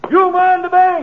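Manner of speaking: pitched high, called back over his shoulder; calling out. Acted. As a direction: shout the order across the bank.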